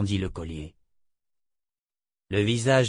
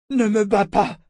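high, loud, frightened whimper; whimpering plea; 11 semitones higher, 7 dB louder than usual. A narrator's reading. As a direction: beg not to be hit. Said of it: "gémit-il".

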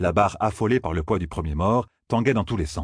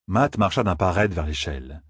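normal talking voice

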